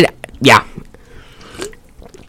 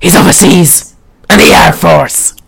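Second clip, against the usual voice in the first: hammed-up movie line; mock melodramatic delivery; overacting old lady. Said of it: "She's so overacting".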